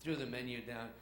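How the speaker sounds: usual speaking voice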